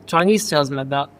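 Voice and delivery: ordinary delivery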